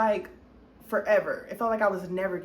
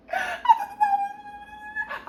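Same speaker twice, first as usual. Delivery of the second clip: high laughing shriek. Real, not acted. Telling viewers she believed she was going home, shrieking laugh. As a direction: cackle at your own naivety. Laughs at her own naivety.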